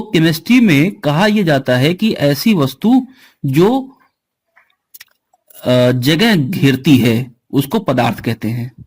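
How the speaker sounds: slow, spelled-out definition for notes; a teacher slowly dictating the definition of matter; slow dictation. Acted. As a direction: dictate it slowly so students can write.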